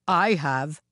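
firm, stern statue voice; a touch of severity. A narrator's reading. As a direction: answer sternly as Jizo. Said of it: "said a very stern Jizo".

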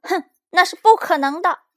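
high, loud, defiant outburst; huff, then yell the refusal; huffy angry reply. A narrator's reading. Child stomping upstairs answering dad back, huffy and angry. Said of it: "一边气呼呼地回答说".

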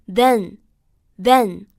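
"then" said alone with a short e vowel; model pronunciation. Shown as a demonstration. Teacher shows students the second word, "then" with short e. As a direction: isolate "then", short e, pause between each. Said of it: "包含了一个短元音".

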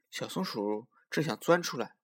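ordinary delivery, nothing marked

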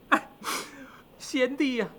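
high, soft mourning cry; grieving demon king, acted; tearful lament. A narrator's reading. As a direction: lament a brother through tears. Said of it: "沾沾眼泪".

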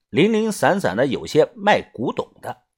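ordinary delivery, nothing marked

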